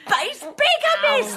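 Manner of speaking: shouted, outraged, pitch leaping up; outraged cry. Acted. Mother-in-law denouncing the husband, shouted in outrage. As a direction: hurl the insult in fury.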